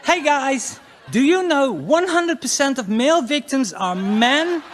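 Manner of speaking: high, loud mock vlogger voice; mock female vlogger. Comic parodying a perky female vlogger, high and loud. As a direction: parody a perky woman online.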